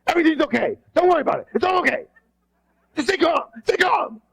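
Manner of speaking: high, frantic reassurance; panicked calm-down; overexcited. Shown as a demonstration. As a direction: leader over-urgent over nothing. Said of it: "being overexcited and over-urgent".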